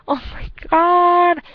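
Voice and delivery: high drawn-out oh my god; delighted squeal. Real, not acted. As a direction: squeal with glee. Overcome recalling funny memory.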